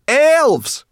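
very high, loud shriek of warning; piercing one-word shout; alarmed cry. A narrator's reading. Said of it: "cried Sorrel".

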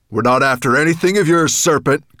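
loud, firm dragon voice; calling out. A narrator's reading. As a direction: call it out boldly. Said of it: "called the dragon".

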